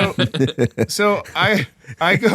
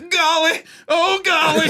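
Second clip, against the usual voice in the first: higher, pained golly; straining moan; 9 semitones higher, slower than usual. Retold or imitated. Man on the toilet, higher pained moaning. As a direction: groan it out mid-effort. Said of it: "as he's firing, he's going".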